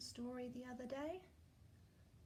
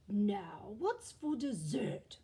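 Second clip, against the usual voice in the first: whispered, slow menacing bear; sly bear; 5 dB louder, much slower than usual. Acted. The bear looking for dessert, slow sly whisper. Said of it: "said the bear".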